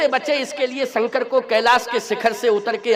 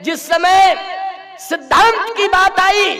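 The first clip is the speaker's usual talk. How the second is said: high, raised preacher's proclamation; ringing oratory; 9 semitones higher, 4 dB louder, slower than usual. Acted. Preacher introducing Maharana Pratap's stand, ringing oratory. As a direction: proclaim it like a heroic turning point. Sermon climax opening a heroic tale.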